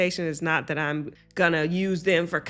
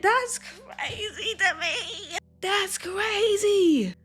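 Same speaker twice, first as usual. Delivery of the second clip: high, stretched-out disbelief; incredulous; 13 semitones higher, much slower than usual. Real, not acted. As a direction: squeal it slowly, drawing out the word. Reacting in disbelief to story.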